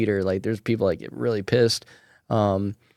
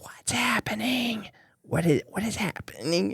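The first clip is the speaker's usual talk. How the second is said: imitated stage whisper; 9 semitones higher, 10 dB quieter than usual. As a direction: whisper it high, baffled, mid-film. Mom whispering loudly during the movie.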